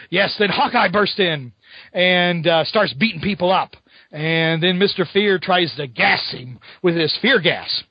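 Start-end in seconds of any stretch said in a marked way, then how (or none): none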